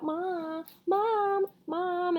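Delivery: put-on high, whiny kids calling Mom; shrill children calling. A narrator's reading. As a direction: call out like pestering children. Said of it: "in these shrill, annoying, insistent voices".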